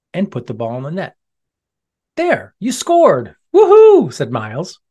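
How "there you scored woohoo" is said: very high, loud whoop; excited cheer. Acted. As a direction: cheer wildly for the friend. Friend cheers the score.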